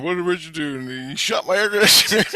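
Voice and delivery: rising hammed-up holler; yelling and screaming. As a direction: act out someone screaming in outrage, climbing in pitch. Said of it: "he's fucking yelling and screaming".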